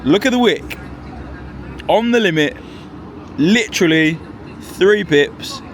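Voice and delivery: thrilled play-by-play of a perfect entry; hyped up. Real, not acted. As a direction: call it out like a sniper shot landing. Price hits limit, hyped up.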